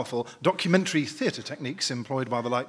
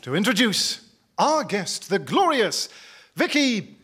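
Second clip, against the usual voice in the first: slow, swelling showman announcement; grand introduction; 6 semitones higher, 6 dB louder, slower than usual. Acted. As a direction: build her up like a star entrance.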